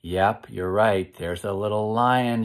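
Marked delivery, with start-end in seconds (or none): none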